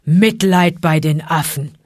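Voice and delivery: slow, loud, rasping bear; scornful rasp. A narrator's reading. Bear scorning the monkeys' pity, scornful rasp. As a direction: rasp it out with contempt. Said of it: "schnarrte Baloo".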